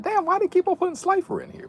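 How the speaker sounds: put-on high-pitched little kid whine; high squeaky kid voice.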